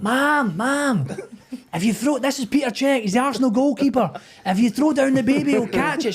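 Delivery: high, loud shouting up to a window; calling up high. Retold or imitated. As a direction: call up to the mother in the tower, loud and high. Crew calling up to the mother.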